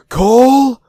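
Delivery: high, loud frightened call of "Cole?"; terrified Coop. Retold or imitated. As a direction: call out in sudden alarm. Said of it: "whenever he's like".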